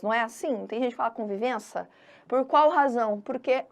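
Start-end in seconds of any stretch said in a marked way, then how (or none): 1.1-1.9 "convivência" quickly, ending -ça with the i dropped; swallowed i. Retold or imitated. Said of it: "engole o i".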